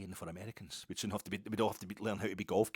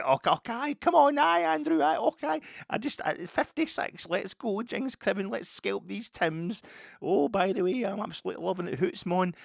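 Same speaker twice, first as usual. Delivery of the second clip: put-on very high, loud camp Scots accent; over-the-top Scottish crowd-pleaser voice; hammed-up cinema Scot; 13 semitones higher, 10 dB louder than usual. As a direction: fawn over the owner like a movie Scotsman. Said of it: "kowtowing, set against doing exactly that for Kavanagh".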